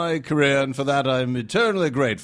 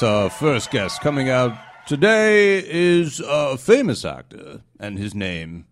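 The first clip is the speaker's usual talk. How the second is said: slow, booming announcer voice, building; 2 semitones higher, 6 dB louder, slower than usual. Acted. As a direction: announce the guest's name big and slow. Co-host announcing the famous guest.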